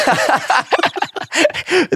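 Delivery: high burst of real laughter. Real, not acted. Comedian laughs at his own gig story, high real laughter. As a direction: laugh out loud at the memory.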